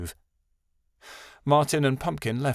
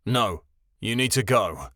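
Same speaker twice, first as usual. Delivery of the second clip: ordinary delivery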